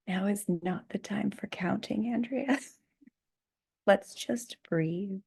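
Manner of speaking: soft teasing whisper, slowing at the end; the universe gently scolding her. Retold or imitated. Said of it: "being like".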